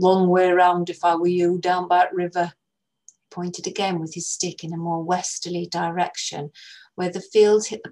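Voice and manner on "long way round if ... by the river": put-on slow, louder northern English old man; old northern English man warning the girls; northern English accent. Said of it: "He spoke slowly and with an accent from further north".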